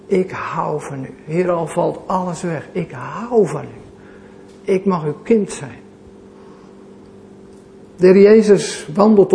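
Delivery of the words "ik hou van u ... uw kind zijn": soft, inward confession of love; hushed, tender words to the Lord; heartfelt quiet prayer. Retold or imitated. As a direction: pray it gently from the heart. Vanuit het diepst van je hart kan zeggen.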